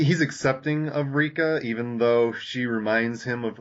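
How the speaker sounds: usual speaking voice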